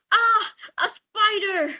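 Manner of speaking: put-on high, slow frightened cry of a boy; scared yell. Boy startled by a spider on his hand, frightened cry. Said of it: "and he yelled".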